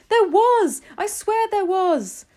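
high, very loud protest; shouting back. A narrator's reading. Cook swearing to doubters there was a mouse, shouting. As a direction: insist at a high-pitched yell. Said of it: "the man was shouting back".

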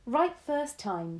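put-on slow, approving old lady; grandma praising her grandson's guess; pleased grandmother. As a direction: savour the praise.

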